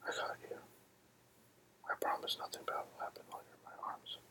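normal talking voice

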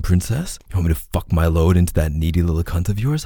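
normal talking voice

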